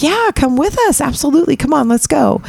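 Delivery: high eager invite. Retold or imitated. Wife urging the narrator to dinner, high and eager. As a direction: gush the invite, way up. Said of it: "she's like".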